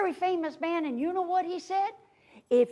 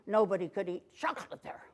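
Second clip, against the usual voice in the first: plain reading voice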